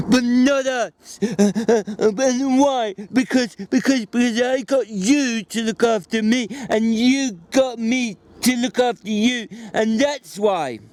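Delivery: Lenny finishing the familiar lines, overjoyed; Lenny, delighted. A narrator's reading. Lenny joins in the recital.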